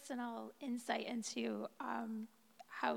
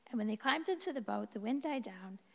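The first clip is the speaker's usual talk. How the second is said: normal talking voice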